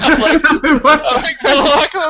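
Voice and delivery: high, loud mocking echo through laughter; laughing mimicry. Teasing Joel over his remark, laughing high mimicry. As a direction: crack up while parroting a friend.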